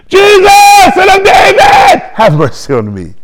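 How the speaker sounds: full-out high shout, falling away at the end; desperate yell for mercy, stretched out. Acted. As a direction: shout it over everyone, then drop the pitch. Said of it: "He got louder".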